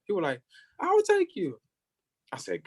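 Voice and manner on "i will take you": very high-pitched driver voice; imitated high call. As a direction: mimic the driver calling out an offer. Driver calls out an offer.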